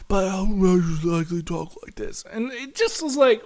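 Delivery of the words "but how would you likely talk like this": strained, whispery villain voice; mocking impression of a croaky film villain; Eddie Redmayne. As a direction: drop back to a hushed mock-villain rasp. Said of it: "Eddie Redmayne doing his impression".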